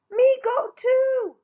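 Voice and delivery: high, slow loud holler; eager holler. Acted. Rella calling after her sisters, loud holler. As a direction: call after them hopefully. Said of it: "Rella hollered after them".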